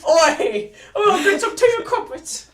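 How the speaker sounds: high, loud laughing mock British accent; cartoon Brit, laughing through it. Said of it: "a British accent".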